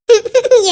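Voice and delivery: short loud laugh, falling away; burst of laughter. Real, not acted. Sister laughs at imagined picture. (வெடிச்சிரிப்பு)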